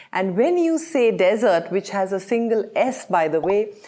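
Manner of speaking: usual speaking voice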